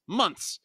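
MONTHS pitched high and exclaimed; "Months" said alone with indignant punch; emphatic repeat. Acted. Repeating the word in outrage.

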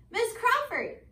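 usual speaking voice

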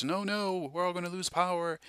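high, frantic imitation of panicked people; mock doomsayer panic, pitched high. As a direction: ham up the fear of losing power.

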